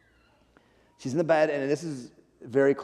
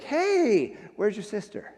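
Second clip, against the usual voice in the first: pitched way up, bright mock eagerness; eager greeting; 8 semitones higher, slower than usual. Pastor greeting churchgoers before church, excited high greeting. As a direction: call it out like spotting a friend. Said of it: "I was like".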